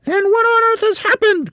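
shrieky high cry, very loud; Missus Twit. A narrator's reading. Wife demanding an explanation from her husband, high loud cry. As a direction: cry it out at the top of your range. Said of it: "cried Missus Twit".

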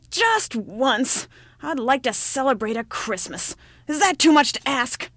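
loud, rising plea flung skyward; shouted up at the stars. Acted. Lonely old woman shouting at the stars, loud. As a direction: shout it to the heavens, fed up. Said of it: "thrust back her head and shouted".

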